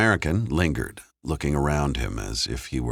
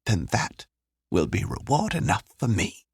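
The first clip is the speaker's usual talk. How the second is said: lightly voiced kind woman, higher pitch; Joan, kindly; 9 semitones higher than usual. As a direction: gently encourage her in a higher voice. Figure in narrated story.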